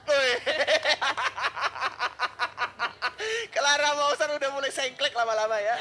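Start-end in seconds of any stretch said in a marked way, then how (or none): 0.4-3.4 laughter. Real, not acted. Cohost laughing at alien bit.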